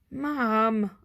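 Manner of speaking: drawn-out, exasperated groan. A narrator's reading. Arthur interrupts his mother's slang lecture, drawn-out groan. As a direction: groan the word out. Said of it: "Arthur groaned".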